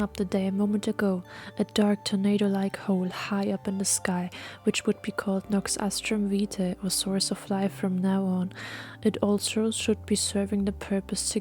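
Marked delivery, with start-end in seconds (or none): none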